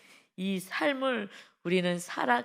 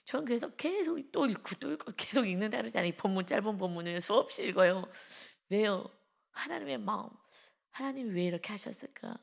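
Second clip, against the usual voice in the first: ordinary talk, nothing marked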